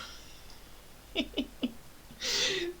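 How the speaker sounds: real high-pitched laughter. Real, not acted. Laughing at her Trinidad story, real high laugh. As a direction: let a genuine laugh spill out.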